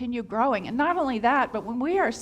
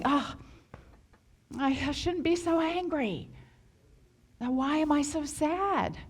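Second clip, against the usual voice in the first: self-critical inner voice; 4 semitones higher than usual. Retold or imitated. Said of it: "talk to ourselves in just that way".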